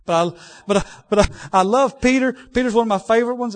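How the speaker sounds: bursting with fondness for Peter; excited aside to the pastor. Real, not acted.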